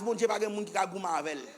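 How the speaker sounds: ordinary talk, nothing marked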